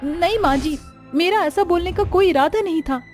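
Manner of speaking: high, anxious apology; frightened pleading. Acted. Bride apologising to mother-in-law. (सहमी बहू की ऊँची, घबराई माफ़ी)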